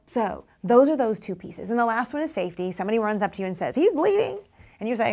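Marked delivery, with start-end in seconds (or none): 3.7-4.4 high, loud urgent cry; alarmed child. Retold or imitated. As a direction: rush in with bad news, panicked. Panicked child runs up.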